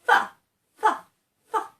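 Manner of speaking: fa with teeth on lip like a rabbit. Shown as a demonstration. Teacher pretending to be a rabbit saying fa. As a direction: play a rabbit blowing fa. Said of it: "pretend you are a rabbit".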